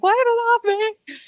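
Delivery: very high, loud mock shriek; retold panicked scream. Screaming at brother over a spider, hammed-up panic. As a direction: imitate shrieking to get a spider off. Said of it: "I'm screaming at my brother".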